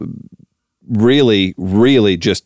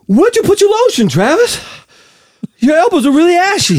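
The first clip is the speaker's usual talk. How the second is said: very high, out-of-breath star impression; Mark Wahlberg; 12 semitones higher than usual. Host teasing Travis, breathless Wahlberg voice. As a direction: needle him in a winded high voice. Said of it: "when you're doing Mark Wahlberg".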